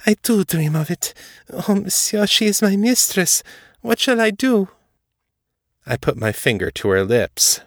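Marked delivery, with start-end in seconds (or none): 0.0-4.7 high, distressed French maid voice; crying out. A narrator's reading. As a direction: confess it fervently, then plead for guidance. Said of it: "she cried".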